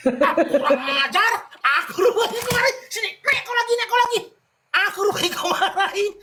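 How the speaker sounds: high, laughing indignation; laughing in disbelief. Real, not acted. Host laughs on realizing the caller scolded him. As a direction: laugh it off while pretending to be offended.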